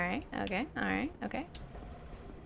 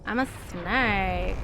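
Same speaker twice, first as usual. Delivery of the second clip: slow, low, quieter snake voice; lightly voiced snake, slowed down; 5 semitones lower, 4 dB quieter, slower than usual. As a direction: drawl it out like a lazy snake. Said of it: "you ever do that?".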